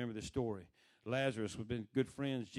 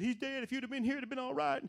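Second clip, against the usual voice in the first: high-pitched lament at normal volume; retold complaint; 10 semitones higher than usual. Martha tells Jesus her brother died, high complaining.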